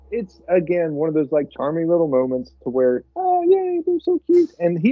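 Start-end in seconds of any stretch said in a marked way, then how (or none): 3.1-4.5 very high, gushing mock squeal; mock cutesy. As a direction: gush in a sarcastic falsetto. Mocking cutesy movie moment.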